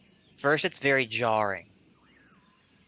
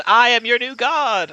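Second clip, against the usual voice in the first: higher, much louder god voice; booming arrogant alien overlord; 14 semitones higher, 13 dB louder than usual. Acted. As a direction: proclaim yourself a god. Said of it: "turns around".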